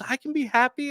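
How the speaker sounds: high mock child's protest; tearful little boy. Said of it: "He goes".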